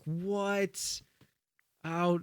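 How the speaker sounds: high, stretched-out disbelief; mock shock. Retelling Kyle hearing about the food order, mock shocked. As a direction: pitch it up like a stunned sketch character. Sketch character reacts in shock.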